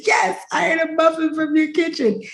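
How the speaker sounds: high-pitched frantic insistence; acted-out squeaky admission, not truly loud; retold panic. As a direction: protest it high, as if freaking out. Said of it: "I was hella loud".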